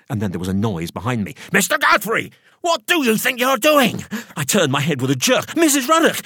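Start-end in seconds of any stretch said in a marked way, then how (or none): 1.5-4.0 high, loud shriek of indignation; shrill-pitched scolding housekeeper; outraged woman's challenge. A narrator's reading. Character caught him snooping, startles him. 5.5-6.2 high, loud cry of surprise; jolted, pitched-up yelp of a name; startled exclamation. A narrator's reading. Startled narrator cries out her name.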